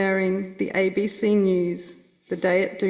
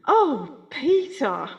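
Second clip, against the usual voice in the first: higher, mock-exasperated Gran; lightly imitating Gran scolding Peter; Gran's exasperated call; 6 semitones higher, much slower than usual. Gran rebuking Gramps Peter, higher and mock-exasperated. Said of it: "that unmistakable".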